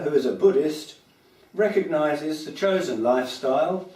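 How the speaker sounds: projected, measured public reading; satirical poem recitation. Acted. Poet reads mock praise of homelessness to an audience, declaimed. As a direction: declaim it in phrases, with punched key words.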